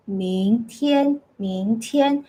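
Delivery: "明" rising, "天" level above it; tone combination drill. Shown as a demonstration. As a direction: repeat evenly, lifting "明" into a flat "天". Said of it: "say that few more times".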